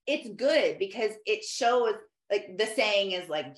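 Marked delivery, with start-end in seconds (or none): none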